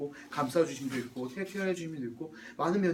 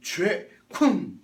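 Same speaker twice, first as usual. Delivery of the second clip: loud blows with a weighty gap between; slamming thud; 3 semitones higher, 8 dB louder, much slower than usual. Acted. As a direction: stamp on sin with each loud word. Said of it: "이렇게 하는 모습이 있다는 거예요". (단호하게 내리찍듯 크게)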